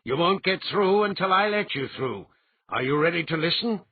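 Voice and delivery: a calm master setting terms; firm confrontation. A narrator's reading. Althalas confronts Gen firmly.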